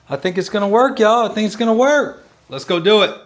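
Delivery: hyped to get going; excited. Real, not acted. Excited about the run plan.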